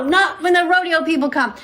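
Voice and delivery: ordinary delivery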